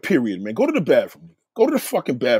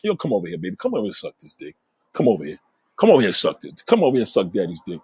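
much lower, coaxing re-enacted voice; low seductive; 8 semitones lower, faster than usual. Man retelling how he beckoned a woman over, low seductive voice. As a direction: play a man coaxing a lover over, pitched way down.

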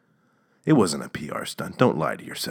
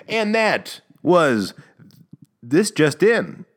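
segment sign-off; 9 semitones higher, much slower than usual. Acted. Closing segment title announcement.

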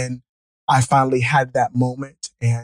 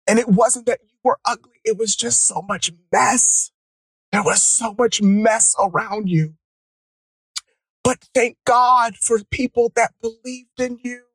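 high, welling-up voice; getting emotional; 7 semitones higher than usual. Real, not acted. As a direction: overcome while retelling kind words. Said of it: "why I'm getting emotional".

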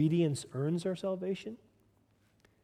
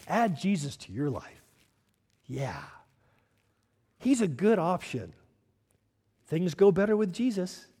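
mock sales pitch; 3 semitones higher than usual. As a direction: sell Jesus like a product, tongue in cheek. Mocking a soft sales pitch.

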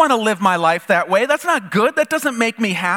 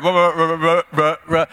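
mock stammering ruh, ruh, ruh, unable to get the word out; stuck on the word; 3 dB louder than usual. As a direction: choke on the word wrong, playfully. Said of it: "can you even say the last word?".

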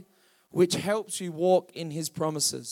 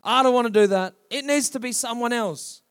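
louder, pleading excuse-maker; whiny Moses plea; 3 semitones higher, 5 dB louder than usual. Retold or imitated. Churchgoer acting like Moses, loud whining plea. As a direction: whine like a reluctant Moses. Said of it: "How many of us get like Moses".

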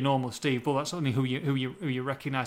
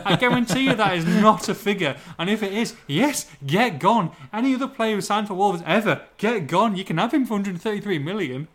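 loud, higher, emphatic rant; emphatic incredulity; 4 semitones higher, 6 dB louder than usual. Real, not acted. Host dismisses a reported transfer fee, emphatic incredulity. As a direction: hammer it home, laughing disbelief behind it.

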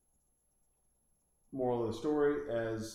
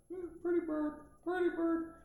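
very high squawky pretty bird; high parrot mimicry of a pet bird. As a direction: chirp it like a talking parrot. Imitating a parrot.

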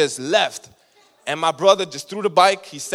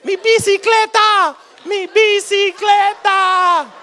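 much higher and louder, shrieking Spanish, the last line stretched slow; hammed-up abuela rushing out in a fury; outraged Puerto Rican grandma; 8 dB louder, slower than usual. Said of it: "she like".

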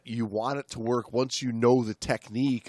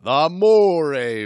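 slow, louder ominous naming; dramatic reading; 4 semitones higher, 9 dB louder, much slower than usual. Acted. Host teasing his co-host about the moray, ominously slow. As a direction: draw out the name for menace.

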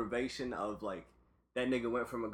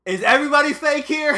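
mock party guy; 13 semitones higher, 12 dB louder than usual.

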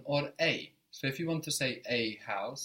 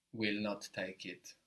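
ordinary delivery

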